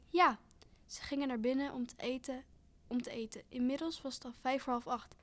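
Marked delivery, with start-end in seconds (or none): 0.1-0.4 bright, higher and louder yes; a cheerful little burst; happy. A narrator's reading. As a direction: answer eagerly and gladly. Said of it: "Ze antwoordde blij".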